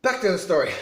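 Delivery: ordinary delivery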